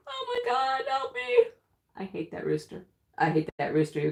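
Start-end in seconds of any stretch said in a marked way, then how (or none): 0.1-1.4 very high squealed plea; mock panic. Woman re-enacts fearing the rooster, panicked squeal. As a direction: squeal it, hamming up helpless terror. Acts out panic under attack.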